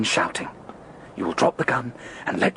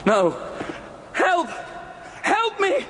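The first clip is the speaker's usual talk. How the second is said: terrified screams; slower than usual. Acted. Victim being attacked, screaming in terror for help. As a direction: scream for your life as he attacks.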